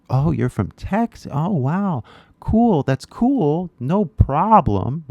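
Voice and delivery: high-pitched, easygoing amazement; pleasantly surprised. Acted. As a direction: react with upbeat, accepting surprise. Acts out discovering someone's home state.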